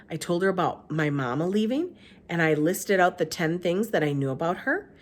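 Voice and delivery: usual speaking voice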